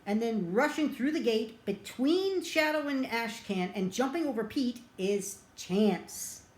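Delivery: ordinary talk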